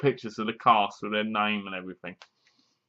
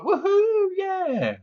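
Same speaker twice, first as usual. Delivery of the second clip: high, excited whoop of victory; whooping cheer; much slower than usual. Pretend. Cheering on the heroine at the movie, high whooping. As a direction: cheer like the crowd just scored.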